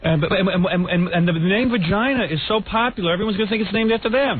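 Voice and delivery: high-pitched, carried-away boasting; excited mock sales pitch. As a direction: pitch climbing as you hype the name. Acted husband raving about the name.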